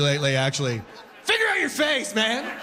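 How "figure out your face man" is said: high, laughing snap; mock scold. Wife scolding sneezing husband, high laughing snap. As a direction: bark it at a sneezer. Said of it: "my favorite "bless you".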